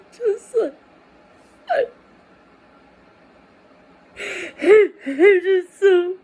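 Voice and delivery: can't get the words out through tears; crying. Real, not acted.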